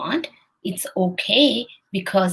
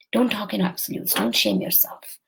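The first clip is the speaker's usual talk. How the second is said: ordinary talk, nothing marked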